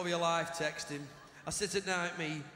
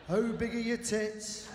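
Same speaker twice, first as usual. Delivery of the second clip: creepy caller voice; slower than usual. Retold or imitated.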